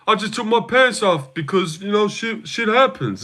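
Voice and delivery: high-pitched comic impression of a fighter; mock deadpan gag in a put-on high voice; Derek Lewis. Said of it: "Like that, that classic".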